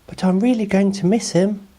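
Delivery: put-on high boy voice, a touch of sadness; Oliver sad. A narrator's reading. Oliver telling Ruby he'll miss Patch, high gently sad voice. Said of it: "trying hard to smile back".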